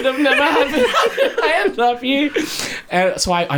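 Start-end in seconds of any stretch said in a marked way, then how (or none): 0.0-2.3 high-pitched comic lament; mock despair. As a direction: lament your fate, half laughing. Acts out his despair, stranded.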